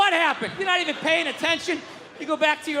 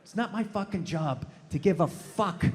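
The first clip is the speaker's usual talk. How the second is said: ordinary talk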